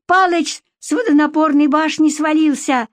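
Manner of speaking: high-pitched alarmed cry; shrill yelling. Acted. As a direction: yell the news shrilly. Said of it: "визгливо завопила вторая тётка".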